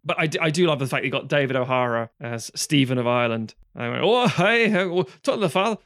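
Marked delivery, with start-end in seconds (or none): 4.0-5.8 quoting the film in a higher, louder mock Scottish accent; a film character's Scottish accent, loud and high. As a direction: ham up a Scottish film line. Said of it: "don't have a fucking Scottish accent there".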